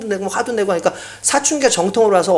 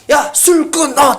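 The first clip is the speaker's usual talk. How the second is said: commanding shout; 8 semitones higher, much slower than usual. Retold or imitated. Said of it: "하나님께서 갑자기".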